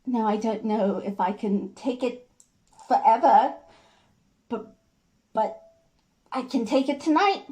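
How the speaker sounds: determined, then wavering; Jenny. Acted. Jenny says she can take it tonight, faltering resolve. Performs Jenny's monologue to George.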